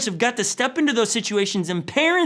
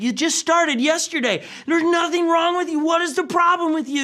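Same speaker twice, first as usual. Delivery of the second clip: higher-pitched mock scolding of a stubborn car; exasperated at the car; 7 semitones higher than usual. Preacher scolding his car that broke down, exasperated. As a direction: nag the car like a misbehaving kid.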